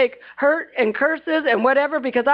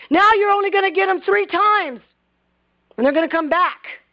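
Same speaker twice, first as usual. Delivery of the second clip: upset scolding; 7 semitones higher than usual. Retold or imitated. Prophet rebuking the king, higher upset scolding. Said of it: "the man of God got so upset".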